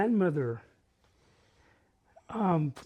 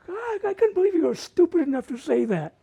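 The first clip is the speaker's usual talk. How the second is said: higher-pitched, mock scornful kid; worldly nine-year-old; 7 semitones higher than usual. Worldly nine-year-old cousin to gullible boy, mock disbelief. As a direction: scold him like a know-it-all older cousin. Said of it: "worldly nine-year-old".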